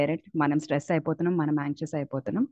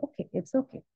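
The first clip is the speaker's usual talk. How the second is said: soothing comfort; 8 semitones higher, 6 dB quieter than usual. Retold or imitated. Comforting a crying child.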